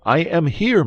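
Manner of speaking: high, loud call; ringing call of reassurance. A narrator's reading. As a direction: shout it so a friend behind a door hears. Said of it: "raising his voice in turn".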